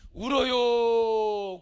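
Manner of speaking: loud, very slow plea; drawn-out exhortation. Real, not acted. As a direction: stretch the word out forcefully. Preacher urges congregation to weep.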